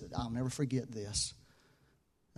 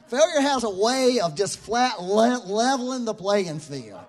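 higher and louder, building sermon climax; preaching at full force; 4 semitones higher, 7 dB louder than usual. Acted. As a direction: drive each point home to the congregation.

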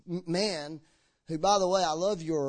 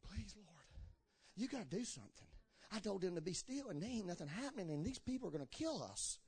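hushed, pleading plea to the Lord, much softer; begging quietly, afraid of the enemy; pleading Moses; 14 dB quieter than usual. Retold or imitated. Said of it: "And then he turns around".

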